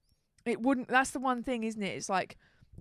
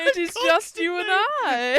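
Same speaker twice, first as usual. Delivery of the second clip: loud, slow, high hammed-up boss; gloating villain; 7 semitones higher, 9 dB louder, slower than usual. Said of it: "he was going".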